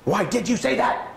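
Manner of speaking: high, sharp scolding question; exasperated parent scolding a kid; mock angry parent. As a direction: snap at the child like a mad parent. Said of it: "we're mad, aren't we".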